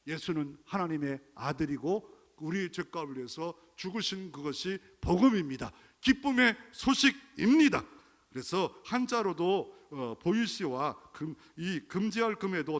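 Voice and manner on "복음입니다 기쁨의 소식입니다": fervent preaching. Acted. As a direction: proclaim it like the best news ever. Preaching peak.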